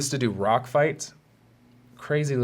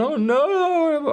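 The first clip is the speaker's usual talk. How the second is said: much higher, louder mock frightened wail; scared cry; 5 dB louder than usual. Kid begging the principal, high frightened wail. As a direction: squeal like a terrified child.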